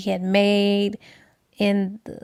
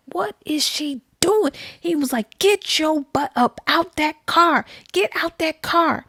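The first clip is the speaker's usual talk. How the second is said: mock going off; 5 semitones higher than usual. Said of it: "he started going off like".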